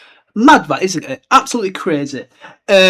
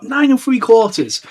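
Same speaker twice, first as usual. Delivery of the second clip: put-on higher, slow little girl's voice; small girl piping; 7 semitones higher than usual. A narrator's reading. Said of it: "a small girl piped out".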